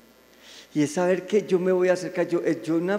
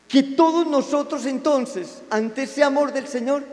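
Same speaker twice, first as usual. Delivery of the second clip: rousing preaching; 5 semitones higher, 4 dB louder than usual. Real, not acted. Preaching climax.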